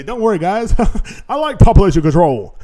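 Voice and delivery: loud mock reassurance, breaking into laughs; Bill Gates. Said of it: "Bill Gates used to say".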